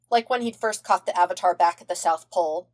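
plain reading voice

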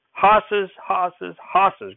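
sharp high snap at the first word, then dropping; snapping. Acted. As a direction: bark the word out three times, the first one high. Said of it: "Grandfather snapped".

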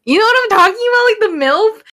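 fast and high, bursting out; excited. Real, not acted. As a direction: rush it out excitedly.

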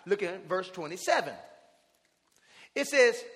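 ordinary talk, nothing marked

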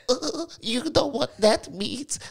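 high, slow know-it-all voice; mock side character. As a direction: ham up the explaining sidekick. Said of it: "side character to go".

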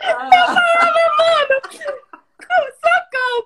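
high, loud, half-crying plea for help; crying out in mock panic; panicked cry. Panicked retelling of proposal.

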